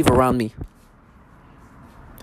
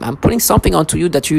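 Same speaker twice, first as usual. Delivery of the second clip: usual speaking voice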